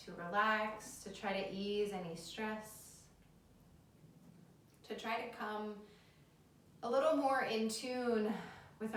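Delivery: soft guided relaxation voice; soothing yoga teacher. Acted. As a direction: ease them gently.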